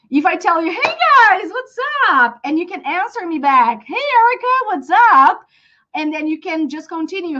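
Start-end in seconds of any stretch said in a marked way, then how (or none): 0.7-2.4 high, singing-out hello to a group; enthusiastic greeting. Acted. Teacher greeting her students as an example, enthusiastic greeting. 3.9-5.5 high-pitched friendly call; enthusiastic greeting back. Acted. Students answering the teacher back, enthusiastic greeting.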